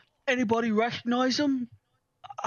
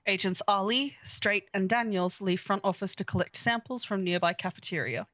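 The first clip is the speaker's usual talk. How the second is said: game master narration; 3 semitones lower than usual. A narrator's reading. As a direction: read the report like a game master.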